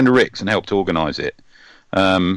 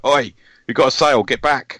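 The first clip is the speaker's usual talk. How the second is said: mock shout, pitched higher; imitated shout; 6 semitones higher than usual. Podcaster imitating a friend shouting over at a table-mate, mock shout. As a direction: call it out like a heckler, half-shouted. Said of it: "Garvey was shouting over".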